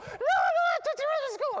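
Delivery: very high, loud mock child's wail; crying little girl. Six-year-old confessing to teacher about candy, exaggerated high crying. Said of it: "This poor little girl's crying".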